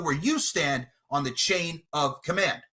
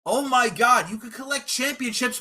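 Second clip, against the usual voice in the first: mocking; 6 semitones higher than usual. Mocking opponent's excitement.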